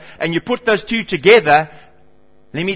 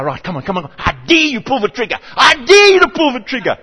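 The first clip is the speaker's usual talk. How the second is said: daring the starter; 6 semitones higher, 4 dB louder than usual. Retold or imitated. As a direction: egg the starter on, louder each time.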